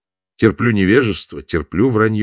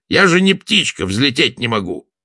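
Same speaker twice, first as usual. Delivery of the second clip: indignant; 7 semitones higher, 3 dB louder than usual. A narrator's reading. Indignant actress retort.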